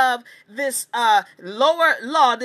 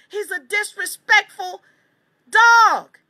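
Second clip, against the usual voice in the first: emphatic outburst; 6 semitones higher, 3 dB louder, slower than usual. Real, not acted. The narrator condemns the poodle with a loud, high-pitched dog. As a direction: punch the final word, high and loud.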